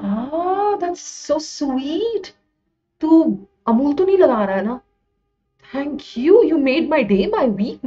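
high-pitched, touched cooing; gushing delight. Real, not acted. Teacher thanking a student for praise, gushing delight. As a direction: gush over a sweet compliment.

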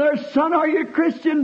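hollering. Retold or imitated. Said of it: "I hollered".

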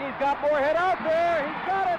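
rising thrilled yell over the play; excited shout. Real, not acted. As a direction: cheer the catch as it happens. Commentator reacts to touchdown catch.

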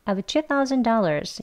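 "have" reduced to "of", words run together; quick native-style delivery; fast connected speech. Shown as a demonstration. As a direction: say it like a native in conversation. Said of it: "빨리 발음을 하면요".

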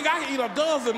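ordinary talk

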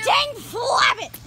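loud, drawn-out frustrated yell; frustrated shout. Real, not acted. Kid missing the ball again.

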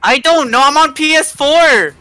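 high, loud exasperated comeback; flustered protest. Real, not acted. Friend who can't help with the controls, high flustered protest. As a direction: protest loudly, pitched right up.

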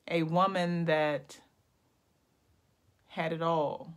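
slow, drawn-out poem recital with long pauses; spoken-word poetry. Pretend. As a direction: make up a poem line by line, savouring each phrase. Improvising a poem aloud.

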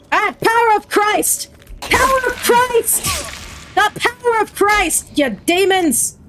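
high, frantic exorcist's chant; mock exorcism cry. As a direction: ward off pretend demons with high cries. Mock exorcism at game demons.

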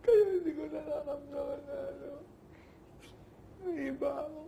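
real tearful high, thin voice; crying. Real, not acted. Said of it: "Huwag ka nang umiyak".

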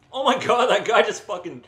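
loud, high-pitched indignation; incredulous loud complaint; outraged yell. Real, not acted. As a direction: explode at being robbed mid-game. Robbed by enemy in game.

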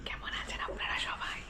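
soft unvoiced whisper. Real, not acted. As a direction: whisper so no one wakes. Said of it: "এত আস্তে আস্তে কেন কথা বলছি?".

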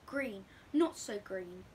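ordinary delivery, nothing marked

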